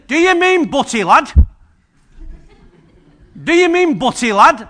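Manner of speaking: mock the puzzled Leeds server, loud; imitating a northern café woman; Leeds woman's question. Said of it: "She said".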